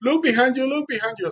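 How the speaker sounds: very high, urgent retold cry; mock screaming. Fisherman to swimmer about the following dolphin, mock screaming. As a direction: imitate someone frantically alerting a friend. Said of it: "he started screaming".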